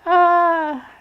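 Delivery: a high ringing holler to hear the echo. Retold or imitated. Child on the knoll hollering for an echo, high call.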